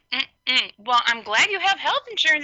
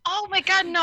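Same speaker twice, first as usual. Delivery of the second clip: ordinary talk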